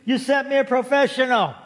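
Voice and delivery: hammed-up grateful wail; crying out loud. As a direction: act out an overjoyed cry to heaven. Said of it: "cried loud".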